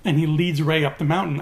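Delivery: ordinary delivery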